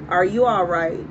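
ordinary delivery

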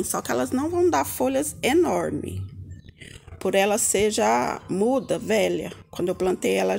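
usual speaking voice

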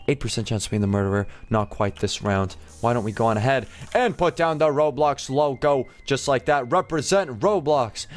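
ordinary delivery

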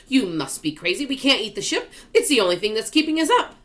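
exasperated objection. Acted. Earthworm objects to eating the ship, exasperated objection. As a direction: argue it with mounting alarm and speed.

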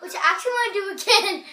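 fast, loud, laughing. Real, not acted. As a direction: laugh through it. Laughing about doing it again.

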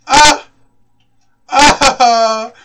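loud, startled scream; panicked yell. Real, not acted. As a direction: cry out under attack. Screams while under attack.

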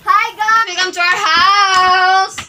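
loud, high, drawn-out greeting; excited welcome. Acted. Host welcoming guests to the house, excited. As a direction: call it out in a big sing-song.

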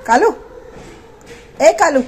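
loud call to a distracted dog. Real, not acted. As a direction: shout his name so he looks up. Calling the dog Kalu. (कुत्ते को ज़ोर से आवाज़ देना)

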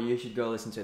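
normal talking voice

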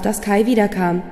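plain reading voice

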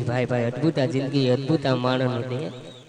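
ભાઈ, ભાઈ in a deep marvelling voice; deep sigh of wonder. Acted. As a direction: drop the voice deep in awe. Deep closing exclamation.

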